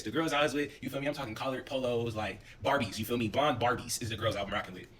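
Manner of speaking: usual speaking voice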